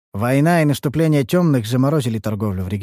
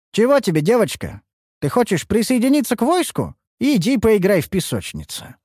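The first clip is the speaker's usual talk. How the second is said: mocking soldier; 11 semitones higher than usual. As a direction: sneer at the little girl. Солдат насмехается над девочкой. (насмешливый солдат)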